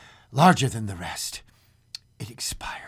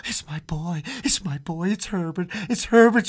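insistent cry; 8 semitones higher, 4 dB louder than usual. Acted. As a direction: build from hushed to loud, high pleading. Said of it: "she cried, struggling mechanically".

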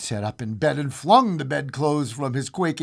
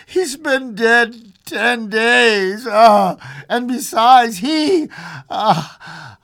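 high, loud, slow and distressed; shaking voice; 8 semitones higher, 8 dB louder, much slower than usual. A narrator's reading. Old man reminding wife of their dead son, shaken. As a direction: drag the words out, voice shaking. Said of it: "and his voice shook".

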